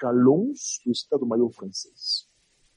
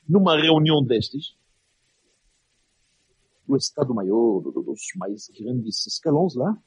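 ordinary delivery

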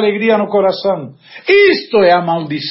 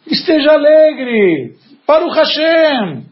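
urging joy; 7 semitones higher, much slower than usual. Real, not acted. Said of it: "preacher urging joy".

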